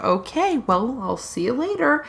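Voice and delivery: small, soft mock-disappointed voice; dismayed. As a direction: retreat quietly, imitating hurt. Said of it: "[name] is dismayed to hear this".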